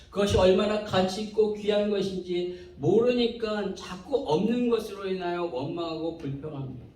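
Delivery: ordinary delivery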